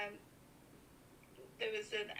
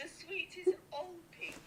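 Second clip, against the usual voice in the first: surprised; 6 semitones higher, 5 dB louder, slower than usual. Real, not acted. Mum hears she sounds sweet.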